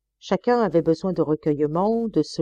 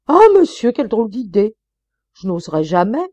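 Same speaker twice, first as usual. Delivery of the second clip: much higher, loud startled cry; shocked protest; 4 semitones higher, 8 dB louder than usual. Acted. Maid startled by her master's strange request. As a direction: gasp out in scandalised surprise.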